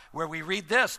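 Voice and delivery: ordinary delivery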